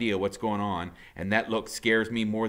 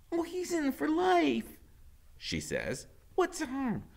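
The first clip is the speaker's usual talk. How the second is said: high giddy woman; slower than usual. A narrator's reading. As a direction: gossip eagerly. Loretta coaxing.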